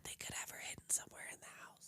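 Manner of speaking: hushed mock-secret whisper. Imitating investigators' whisper meeting, hushed whisper. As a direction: whisper like a huddled conspirator. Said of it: "they have a little whisper meeting".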